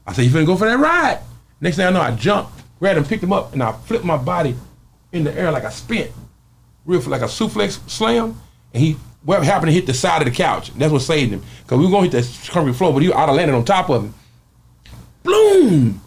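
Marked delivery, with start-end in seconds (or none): 0.2-1.2 loud taunt. Retold or imitated. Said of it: "I said it out loud". 15.2-16.0 high loud boom; slam sound effect. Retold or imitated.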